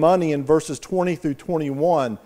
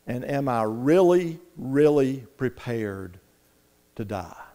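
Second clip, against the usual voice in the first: softer and lower, slowing to the end; hushed solemn question; 5 semitones lower, 5 dB quieter than usual. Acted. Preacher asking the congregation, solemn and hushed. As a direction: let it sink to a hush.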